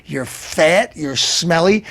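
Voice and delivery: usual speaking voice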